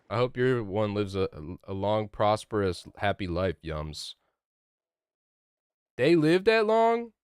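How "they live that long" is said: shocked high question; surprised. Real, not acted. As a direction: sound genuinely shocked.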